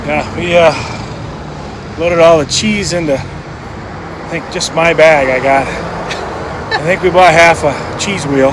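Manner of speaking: puffing while hauling a heavy bag; breathless, huffing between words; out of breath. Real, not acted. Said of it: "That's why I'm huffing and puffing".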